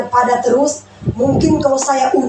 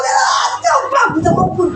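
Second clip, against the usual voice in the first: high shouted demand; mock shout; 7 semitones higher than usual. Husband shouting at his wife in the quarrel. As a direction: bark it at her across the room.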